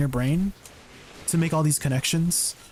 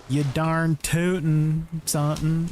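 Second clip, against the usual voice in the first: slow Southern drawl; American Southern accent; slower than usual. Acted. Streamer recalling how Southerners sound, drawled Southern accent. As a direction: put on a slow, hammed-up Southern twang. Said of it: "American Southern accent".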